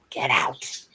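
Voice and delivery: low eerie spirit voice; ghostly voice. Retold or imitated. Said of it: "and it said".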